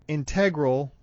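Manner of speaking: "integral" stressed on the middle syllable, in-TEG-ral; stress on second syllable. Shown as a demonstration. Introducing a tricky word, "integral" stressed on TEG. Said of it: "Or integral".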